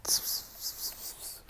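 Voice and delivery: soft hissed tss bursts; sizzling explosion noise. Retold or imitated. Storyteller showing a hand quirk going off, imitated sizzle. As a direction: imitate little sparks popping, voiceless. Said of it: "his little hand explodes".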